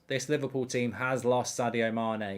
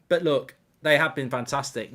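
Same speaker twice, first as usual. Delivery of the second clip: normal talking voice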